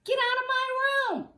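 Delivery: put-on high, loud childish yell; shrieking get out of my room; shouted. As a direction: yell it like an angry little girl. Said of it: "So I shouted".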